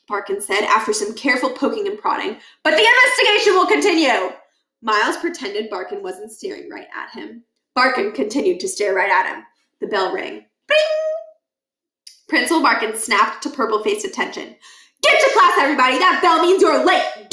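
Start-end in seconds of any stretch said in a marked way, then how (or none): 2.6-4.3 fast loud high proclamation; stern vow. Acted. Barkin announcing to the crowd, loud pompous vow. 15.0-17.3 loud high shouting principal; barking order. Acted. Principal Barkin shouting at the students to leave.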